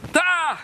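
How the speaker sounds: high-pitched kihap; "tah" cried high with the strike; demonstrated kick yell. Shown as a demonstration. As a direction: punch out a sharp martial-arts cry. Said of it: "seperti itu".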